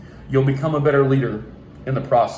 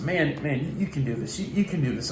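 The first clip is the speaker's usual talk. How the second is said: soft, much quieter coaxing; gentle encouragement; 3 semitones lower, 7 dB quieter than usual. Retold or imitated. Youth pastor coaxing a scared boy, softly. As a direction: talk a scared kid into it, softly. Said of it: "And I said".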